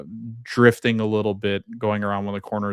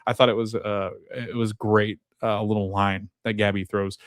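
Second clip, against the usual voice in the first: usual speaking voice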